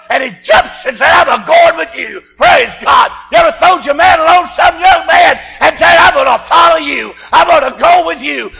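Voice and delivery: loud, high shouted preaching; fiery preaching. Acted. Preacher to his church, full-force shouted sermon.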